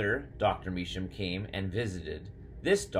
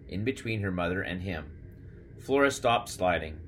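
plain reading voice

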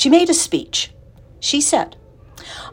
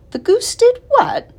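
high, slow disbelieving question; incredulous; 4 dB louder, slower than usual. A narrator's reading. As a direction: ask it in disbelief. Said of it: "asked Missus Arable".

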